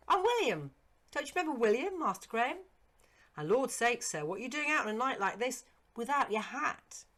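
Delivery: high, loud, eager servant's voice; eager old servant. Acted. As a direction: pitch up as the startled, fond old retainer. Servant William's voice.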